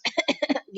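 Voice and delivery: demonstrative throat-clearing cough; short mock cough from the throat; imitated cough. Shown as a demonstration. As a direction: fake cough to show a throat sound. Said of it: "kayak orang lagi batuk".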